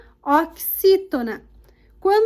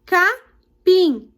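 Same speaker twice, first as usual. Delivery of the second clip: "capim" in two separate beats, PIM stressed; 4 dB louder than usual. Shown as a demonstration. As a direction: split "capim" into CA, then a strong PIM. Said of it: "são as sílabas tônicas". ("capim" em sílabas separadas, tônica no PIM)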